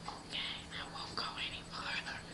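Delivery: very soft, compliant whisper; whispered agreement. Acted. Girl promises the guy she'll stay put, whispered. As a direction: whisper it obediently.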